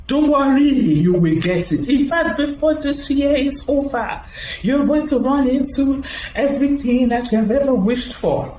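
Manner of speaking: a hint of the preacher's promise; imitating pastor.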